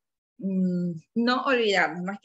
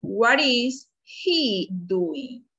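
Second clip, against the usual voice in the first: very slow, drawn-out classroom question; lingering, clearly separated words; slow modelled question; 3 semitones higher, much slower than usual. Shown as a demonstration. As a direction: stretch every word for learners. Teacher models question word by word.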